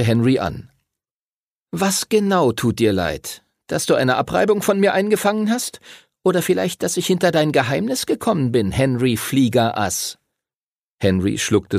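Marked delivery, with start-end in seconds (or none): 1.7-10.2 put-on a sneering boy rubbing it in; Timothy's voice. A narrator's reading. Timothy taunts Henry about his secret, mocking.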